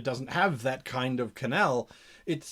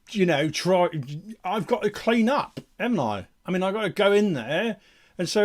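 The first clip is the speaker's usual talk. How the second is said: fed-up lowly cleaner grumbling in a raised voice; put-upon underling; 3 semitones higher, 4 dB louder than usual. Acted. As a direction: play an exasperated dogsbody airing grievances.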